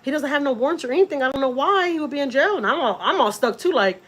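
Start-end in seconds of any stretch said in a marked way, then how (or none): 0.0-2.6 high, pleading mock wail; pitched-up tearful voice, no real sobs; retold crying. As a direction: imitate a distraught girlfriend's protest. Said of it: "She's all crying".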